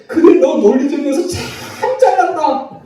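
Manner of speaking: imitating a wife crying out a sarcastic jab; tearful sarcastic wife. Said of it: "눈물이 팍 나면서 하는 말이". (울먹이며 비꼬는 아내 흉내)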